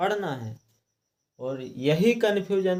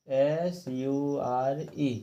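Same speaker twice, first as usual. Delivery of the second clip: slow spelling; 3 dB quieter, much slower than usual. Shown as a demonstration. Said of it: "spelling out letters".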